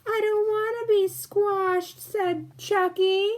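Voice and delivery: put-on a frightened little boy pleading not to be squashed; scared baby voice. Chucky protests being squashed in a high scared baby voice.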